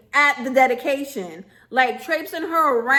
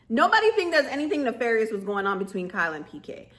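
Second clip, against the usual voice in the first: normal talking voice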